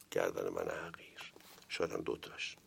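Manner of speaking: a sly, hushed confession; whispered aside. Real, not acted. Lecturer jokes about his own faulty reading, whispered. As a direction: whisper it as a joke against yourself. Self-deprecating joke aside.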